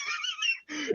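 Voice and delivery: very high, soft squeal of laughter; helpless laugh. Real, not acted. Storyteller losing it over the rant, squealing laugh.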